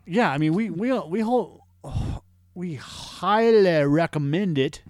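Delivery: high, drawn-out Southern drawl; slow Southern gentleman, stretching the words. Acted. Podcast host endorsing a movie to listeners, Southern gentleman. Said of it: "going into a Southern Gentleman".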